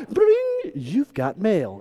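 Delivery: computer greeting. Retold or imitated. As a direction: mimic the dial-up chime and the mail voice. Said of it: "and it was like".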